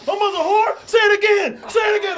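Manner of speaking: very high, loud enraged shout; furious yelling. Retold or imitated. Calm guy exploding at the drunk who insulted his mother. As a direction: scream it, daring him to repeat it.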